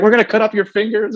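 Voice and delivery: joking about the draft, mock frantic parent. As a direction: squeal it like a panicking mom. Parents' fear joked.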